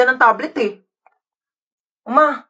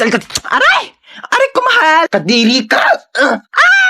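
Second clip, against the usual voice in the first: yelping; 5 semitones higher than usual. Acted. Wife grabs him, he yelps.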